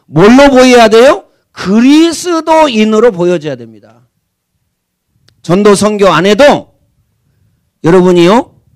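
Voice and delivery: slow, loud sermon lines; emphatic preaching. Acted. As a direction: press the point on the congregation. Preacher presses main point. (강조하는 설교 어조)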